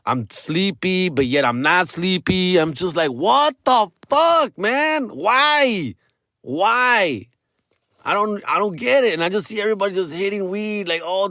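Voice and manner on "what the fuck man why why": higher and slow, utterly bewildered; baffled stoned outburst. Retold or imitated. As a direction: drag out the confusion like nothing makes sense. Quoting his confused stoned self.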